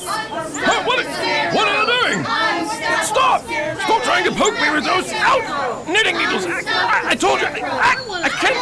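high, panicked stammering; flustered, squeaking in alarm. Acted. Guard poked with knitting needles.

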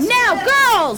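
calling out. Acted. Signals the attack.

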